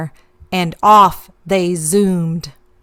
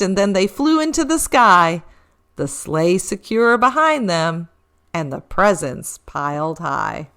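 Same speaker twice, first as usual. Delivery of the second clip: ordinary delivery, nothing marked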